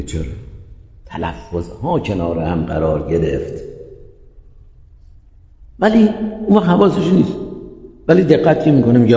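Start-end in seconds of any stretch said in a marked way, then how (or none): 0.0-3.6 musing realization. Acted. Teacher voicing a speaker who notices the separate pronunciations, quietly musing. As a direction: think it through aloud, low and soft.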